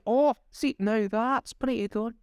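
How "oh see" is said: ordinary talk, nothing marked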